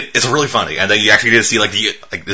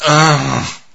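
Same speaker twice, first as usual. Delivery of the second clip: weary groan; 4 semitones higher than usual. Acted. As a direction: groan it out, drawn and fed up. Imitates weary reaction to endless zombies.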